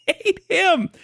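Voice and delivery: words squeezed out through real laughter; wheezing laugh breaking through the words; laughing hard. Real, not acted. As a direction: crack up mid-line, almost crying with laughter. Cracking up at his own point.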